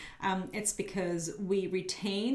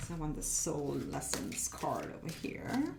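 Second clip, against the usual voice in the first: whispered aside to herself; 4 semitones lower, 5 dB quieter than usual. Real, not acted. Whispering to herself while arranging the cards. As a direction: breathe it out under her breath while placing a card.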